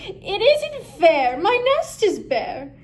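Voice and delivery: lonely duck wailing about his empty nest; whiny complaint. Acted. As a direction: whine it like a lonely, sulky duck.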